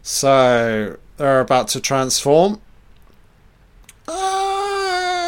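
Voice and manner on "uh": high monster screech. Pretend.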